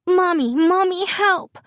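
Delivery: put-on very high demanding child's cry; commanding little Grace. A narrator's reading. Sick daughter calls mom for help, commanding child's voice. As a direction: order mom around in a squeaky little voice. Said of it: "Grace commands".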